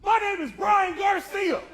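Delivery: high, loud, slow caller voice; mock angry caller. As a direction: play the irate caller, hammed up.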